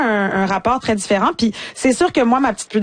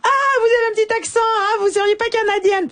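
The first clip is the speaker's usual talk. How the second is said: much higher, fast, prissy European French voice; mocking European French; 12 semitones higher than usual. A European teasing her Québec accent, high, fast, mock French. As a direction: mimic a Parisian noting her little accent, much higher and quick. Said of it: "il y a toujours quelqu'un pour imiter mon accent".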